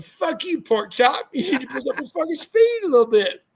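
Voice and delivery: high, hammed-up coach snapping back; mock a cocky coach, pitched high. Walker voices a coach's retort.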